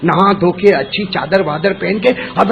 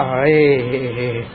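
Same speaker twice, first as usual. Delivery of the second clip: dismayed sigh; 9 semitones lower, 8 dB quieter, much slower than usual. Acted. Preacher voices Umar seeing blood.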